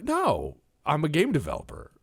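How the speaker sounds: high-pitched protesting denial; mock indignant. Game developer answering a blunt interviewer, mock indignant.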